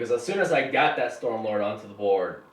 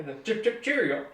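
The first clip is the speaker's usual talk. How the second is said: higher, mock-posh English accent; 8 semitones higher than usual. Teasing his English friend in a mock-posh English accent. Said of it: "that annoying English accent".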